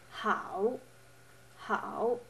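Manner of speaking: "how" dipping then rising; third tone. Shown as a demonstration. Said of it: "The third one".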